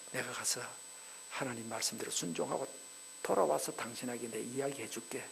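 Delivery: husband silently apologizing to his wife; inner apology. Retold or imitated. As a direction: murmur it to yourself. Abraham thinking inwardly.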